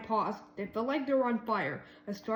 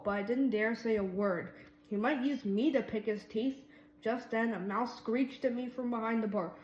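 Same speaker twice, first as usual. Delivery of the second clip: usual speaking voice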